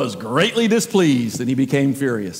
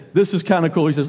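usual speaking voice

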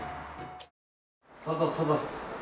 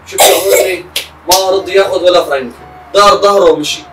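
raised, loud voice, full of reproach; loud telling-off; 3 semitones higher, 12 dB louder than usual. Acted. Father scolding his son about the friend, loud. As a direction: hammer the point home loudly.

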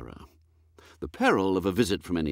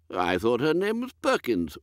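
put-on high, dithering old lord, higher and fluttery; a woolly, absent-minded aristocrat, higher pitched; vague old earl; 4 semitones higher than usual. Lord puzzling over dinner with his butler, high and dithery.

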